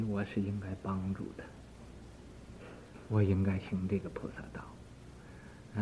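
soft, half-whispered musing; hushed thinking to himself; inner thought. Acted. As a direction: think it through under your breath. Shariputra thinks it over inwardly.

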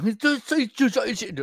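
mock a thick British accent you cannot follow; imitating a thick accent. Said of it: "Peaky Blinders ... no entiendo". (imitando el acento cerrado de Peaky Blinders)

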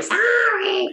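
high shouted Sorry; mock game shout.

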